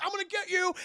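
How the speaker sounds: mock taunt. Stabbing floor, taunting.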